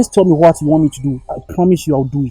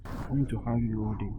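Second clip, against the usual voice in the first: tender; 7 semitones lower, 17 dB quieter than usual. Acted. Tender end of scene.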